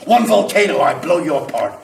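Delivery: loud, boastful tirade of power; carried away. Acted. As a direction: boom it out like fire challenging the world. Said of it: "I'm getting carried away".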